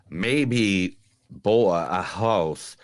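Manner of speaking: put-on slow, drawn-out Southern accent quote. Podcast host quoting the movie in a Southern accent. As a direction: stretch it out in a thick Southern accent. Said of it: "the Southern accent for every single quote".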